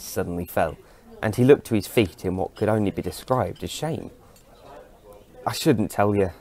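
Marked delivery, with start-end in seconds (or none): none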